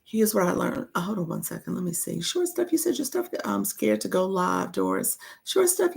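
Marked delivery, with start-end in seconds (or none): 2.2-3.3 high greeting. Real, not acted. 5.5-6.0 high greeting. Real, not acted.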